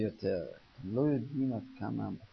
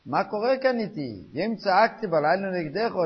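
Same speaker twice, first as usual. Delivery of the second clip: raised, higher voice of protest; crying out; 6 semitones higher, 5 dB louder than usual. Acted. Man protesting to God the next morning, crying out. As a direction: cry it out as an indignant question. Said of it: "אז למחרת הוא מגיע וצועק".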